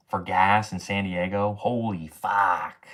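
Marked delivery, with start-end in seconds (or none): 1.6-2.9 stunned disbelief. Real, not acted. Shocked at gas prices.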